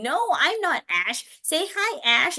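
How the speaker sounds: high, insistent prompting; mock instruction in a high pitch; telling a child. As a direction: re-enact correcting a little boy. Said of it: "me telling him".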